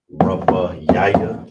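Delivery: Rabba Yaya said slowly and loudly, rising at the end; slow, emphatic model. Shown as a demonstration. Teacher announces the word for fourth slowly and loudly. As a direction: give Rabba Yaya slowly with a strong lift. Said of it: "Here's the breakdown".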